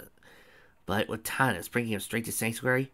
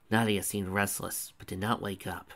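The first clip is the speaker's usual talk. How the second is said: plain reading voice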